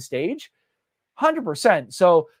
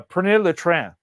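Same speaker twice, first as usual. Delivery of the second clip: bad French accent; 3 dB louder than usual. Real, not acted. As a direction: attempt French with an English speaker's accent. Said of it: "pardon my, uh, ac-, bad accent".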